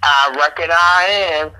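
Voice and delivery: slow, drawled country twang; lightly put-on country drawl; country bumpkin drawl. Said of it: "Are you a country bumpkin".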